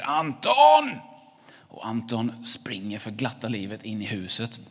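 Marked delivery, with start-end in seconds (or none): 0.0-1.0 high, loud holler of a name; angry shout. Acted. Angry neighbour shrieks at Anton from car, furious shout. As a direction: yell it with a long held last syllable.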